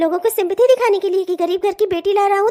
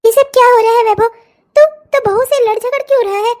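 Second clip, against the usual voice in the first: scolding; 3 semitones higher, 5 dB louder than usual. Acted. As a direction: scold him at once. Mother scolds quarrelling son. (झगड़ते बेटे को डाँटती माँ)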